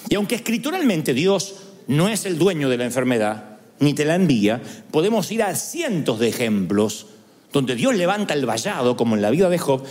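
ordinary delivery

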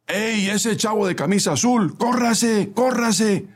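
high-pitched urgent call; shouting. A narrator's reading. Bus helper calling a man in a blue shirt, shouting. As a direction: holler for someone to hurry. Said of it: "gritó".

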